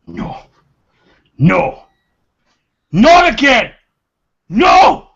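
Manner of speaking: a hushed no that snaps into despairing yells; building anguished denial. Acted. Durgan breaks down after the loss.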